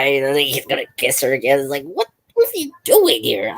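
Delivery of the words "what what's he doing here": high-pitched, put-on surprised question; retold surprise, pitched way up; mock surprise. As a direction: act out a surprised double take, voice jumping up. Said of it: "Like, I'm surprised".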